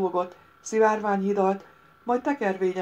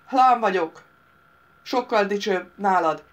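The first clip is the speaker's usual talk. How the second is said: loud bragging chieftain; boastful shout; 2 semitones higher, 7 dB louder than usual. A narrator's reading. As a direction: trumpet your own name. Leader boasts his name.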